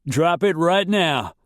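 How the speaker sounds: high, urgent shout; yelled order. A narrator's reading. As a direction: yell a command over the screaming. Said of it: "a man yelled".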